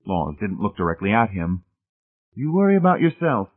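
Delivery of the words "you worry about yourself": high-pitched, husky retort; husky, raised-pitch comeback. A narrator's reading. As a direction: warn him off with a touch of huskiness. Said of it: "he said huskily".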